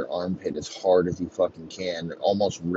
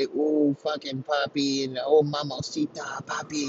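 mock Latin accent; 7 semitones higher than usual.

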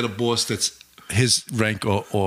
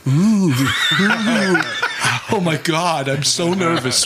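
sarcastic spooky oohing, slightly raised; mock fear; 3 semitones higher, much slower than usual. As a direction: feign nerves to ridicule the threat. Sarcastic reaction to chief's name.

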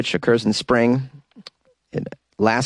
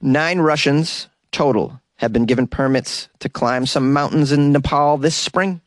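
ordinary talk, nothing marked

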